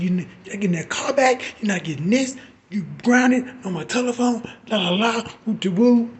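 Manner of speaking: high, loud, fast mock rant; high, loud hammed-up father mockery; mocking angry dad. Father grounding her, mocking.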